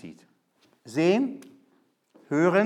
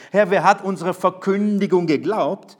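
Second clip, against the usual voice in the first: loud, ringing cry of the prophet's question; preaching; 4 semitones higher, 7 dB louder than usual. Acted. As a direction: call it out to the hall. Quotes Jesaja's lament. (laut ausrufend predigen)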